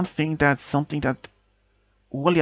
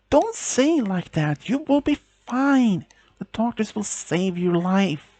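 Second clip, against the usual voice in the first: pleading; 7 semitones higher than usual. Acted. Girlfriend begs dying fiancé.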